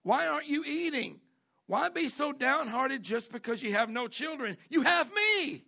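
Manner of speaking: high, loud, playful husband voice; hammed-up husband consoling his wife; husband offering himself, high and sweet; doting husband. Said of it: "Elkanah would ask".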